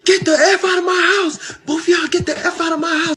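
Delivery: high-pitched mock scream; retold scream. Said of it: "she starts screaming".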